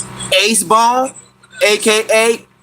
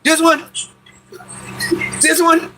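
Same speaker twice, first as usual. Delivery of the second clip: quick, eager questions, looking around for the target; scanning the crowd, ready to pounce; eager, searching; 6 semitones higher than usual. Shown as a demonstration. Retelling how he eggs on his homies, eager and searching. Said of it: "And I be talking like this".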